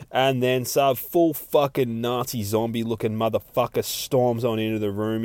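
normal talking voice

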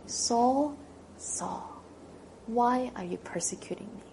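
compassionate call fading to a whisper; gentle rebuke. Acted. Jesus gently rebuking Saul, compassionate hush. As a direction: call him tenderly, then hush. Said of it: "in a tone of compassion and gentle rebuke".

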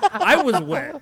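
loud laughing confession. Real, not acted. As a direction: burst out laughing, then say it loud. Laughing at innuendo.

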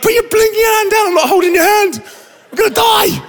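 high, frantic mock shout; hammed-up hysterical outburst; panicked shouting. Acted panic at bandmate on plane.

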